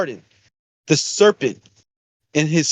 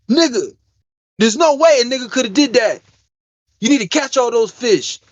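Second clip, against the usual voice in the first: raised, worked-up imitation of a friend; mock rant, pitched up; 6 semitones higher than usual. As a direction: play the buddy getting heated about God. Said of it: "He started going crazy".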